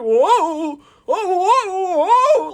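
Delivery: high, wobbly, goofy slipping cry; a clumsy engine sliding around; goofy whoa. Acted. Booster sliding about goofily.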